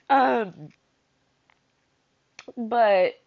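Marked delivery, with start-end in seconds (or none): none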